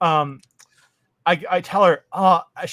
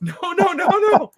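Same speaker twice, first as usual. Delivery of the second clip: mock protest; 5 dB louder than usual. Mock protesting extra food.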